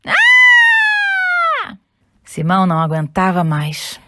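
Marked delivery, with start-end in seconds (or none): 0.1-1.8 very high, loud shriek; terrified scream. Acted. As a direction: shriek in terror. Scared of spider.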